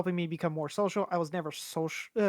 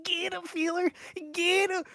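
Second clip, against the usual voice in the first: dumb gamer yelling; 12 semitones higher than usual. Retold or imitated. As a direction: imitate a dumb player screaming orders, pitched way up. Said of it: "this guy is just like".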